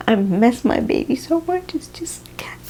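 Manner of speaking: choking up mid-apology, barely getting words out; real crying. Real, not acted. She breaks down telling viewers she misses her son, tearful whisper. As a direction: voice breaking high, fading to a whisper. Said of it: "I'm getting emotional and emotional again".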